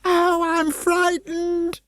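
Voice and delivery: very high, slow wail; frightened wail. Acted. Ingrid wailing in terror, very high and slow. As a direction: let the fear stretch the words. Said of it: "Ingrid wailed".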